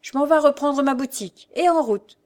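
high, loud, cheerful compliance; smiling. A narrator's reading. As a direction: take the order with a smile. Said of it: "avec un sourire qu'il dit à sa mère".

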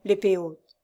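ordinary delivery

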